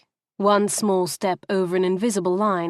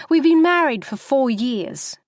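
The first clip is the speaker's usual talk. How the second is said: higher, heated telling; rising fury; 6 semitones higher, 4 dB louder than usual. Acted. Wife complaining to taxi driver, fury building. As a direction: let the fury start to climb. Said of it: "becoming more exhilarated with fury".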